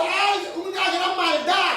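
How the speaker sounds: the archangel shouts a warning through heaven; alarmed shout. Retold or imitated. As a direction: sound the alarm at the top of your voice. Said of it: "pegó un grito y dijo".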